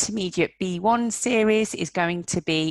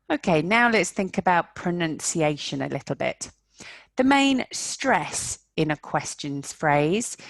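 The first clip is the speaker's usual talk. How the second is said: ordinary talk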